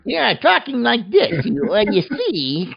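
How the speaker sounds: high-pitched, hammed-up fake American accent; horrible American accent. Shown as a demonstration. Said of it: "whole review in that accent".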